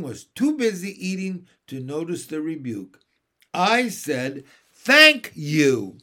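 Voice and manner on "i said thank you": loud, emphatic repeated thanks; louder. A narrator's reading. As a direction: hit THANK hard as a rebuke. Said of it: "Mary Poppins a little louder".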